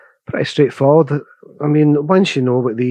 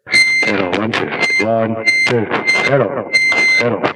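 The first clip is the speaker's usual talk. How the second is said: echo effect; 2 semitones lower than usual. Real, not acted. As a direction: voice through radio echo. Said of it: "So you can hear it".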